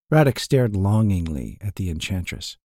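plain reading voice

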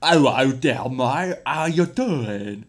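loud, exaggerated English accent, drawn out at the end; mock English accent. Host imitating people who do an English accent, exaggerated. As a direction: ham up a matey English accent. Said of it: "people who go like".

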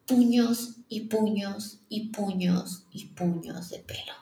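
hushed and slow, words breathed out; slow shaken whisper. Real, not acted. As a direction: whisper it in disbelief at the handfuls of hair. Pulling out fistfuls of hair.

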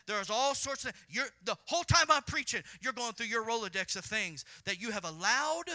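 loud pulpit call; full-force preaching. Acted. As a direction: raise the voice to the congregation.

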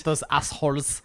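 put-on playful German-accented echo. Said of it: "Nick has a really good German accent".

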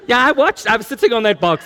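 ordinary talk

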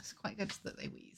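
whispering. Real, not acted. Quiet aside.

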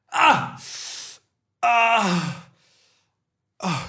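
high cries of pain; pained screams. Acted. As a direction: screech as wax hits. Wax on own eyes.

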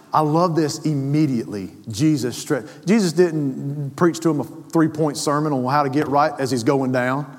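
ordinary talk

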